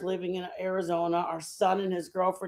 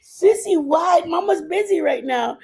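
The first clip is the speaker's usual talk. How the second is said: higher, louder call to the dog; 8 semitones higher, 8 dB louder than usual. Real, not acted. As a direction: call across the room to Sissy. Calling to the dog.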